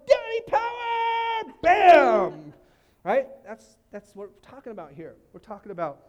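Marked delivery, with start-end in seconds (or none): 0.1-1.5 put-on much higher, slow child shout; daughter's shout. Daughters dunking and shouting to their dad. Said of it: "They're like". 1.6-2.5 much louder shouted Bam. Pretend. Pastor acting out the girls' dunk with a bam.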